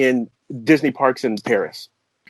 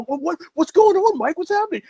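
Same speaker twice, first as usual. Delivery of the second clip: alarmed wife. Retold or imitated.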